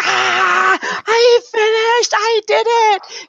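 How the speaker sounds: hammed-up thrilled squeal of relief; mock elated scream. Retelling the guest's scream of joy after finishing, mock elated. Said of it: "biggest scream I've ever heard".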